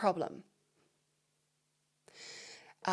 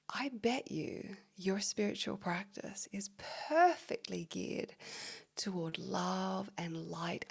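hushed quote; 7 dB quieter, slower than usual. Retold or imitated. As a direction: whisper it slowly and knowingly, imitating him. Quoting teacher's intimate remark to her.

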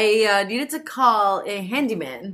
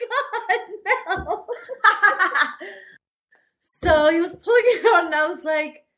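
high, giggling through the words; can't stop laughing; 10 semitones higher, faster than usual. Real, not acted. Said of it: "Kat is laughing so hard right now".

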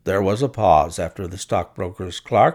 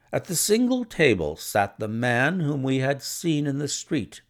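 ordinary delivery, nothing marked

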